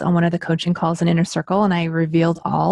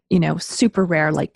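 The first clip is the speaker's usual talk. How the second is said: ordinary talk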